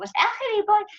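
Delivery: high, loud self-insult; self-mocking outburst. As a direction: laugh at yourself, calling out. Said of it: "Y es como".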